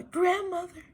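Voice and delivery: put-on wolf faking a sweet little girl. Wolf faking a little girl's voice at grandmother's door. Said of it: "in his best little girl's voice".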